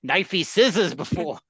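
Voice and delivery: laughing Aussie tough-guy voice; Crocodile Dundee impression. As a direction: ham up an Australian bushman boasting. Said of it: "He's like".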